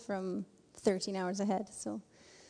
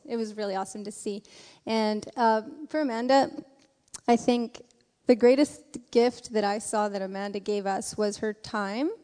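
ordinary delivery

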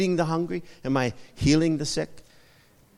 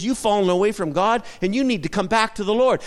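bold admonishing; 6 semitones higher, 6 dB louder than usual. Retold or imitated. Bold mother admonishes a stranger.

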